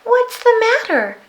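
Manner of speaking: put-on high, bright-eyed child asking; a curious small girl checks on a sad creature; little girl voice. A narrator's reading. Little girl asks the Yeti what's wrong, high and curious.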